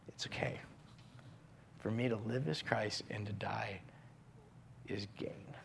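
hushed devotional whisper; reverent whisper. Acted. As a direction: breathe the verse like a secret. Whispered repetition of verse.

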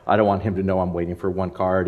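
ordinary delivery

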